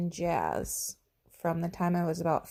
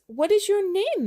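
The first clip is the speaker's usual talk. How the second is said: high, louder lady's voice; teacher asking the dinosaur, high and louder; teacher's question; 12 semitones higher, 5 dB louder than usual. Acted. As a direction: ask sweetly in a high voice.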